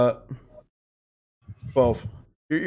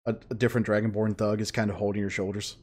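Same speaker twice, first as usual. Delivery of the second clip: normal talking voice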